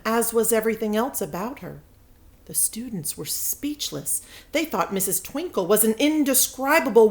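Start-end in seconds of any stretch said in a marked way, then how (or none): none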